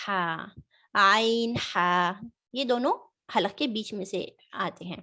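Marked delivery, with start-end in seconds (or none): 0.9-2.1 عین ہا drawn out slowly from the throat; slow model of عین and ہا; slow letter demo. Shown as a demonstration. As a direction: stretch عین ہا slowly, a throat sound each. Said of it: "ہا کی آواز آئے گی".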